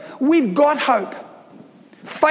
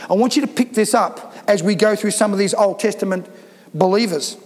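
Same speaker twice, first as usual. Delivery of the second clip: usual speaking voice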